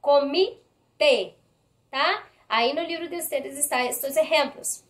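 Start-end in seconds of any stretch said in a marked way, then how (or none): 0.0-1.4 "Comité" slowly split, the final "té" set apart; stressed last syllable. Shown as a demonstration. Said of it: "última sílaba de la palabra".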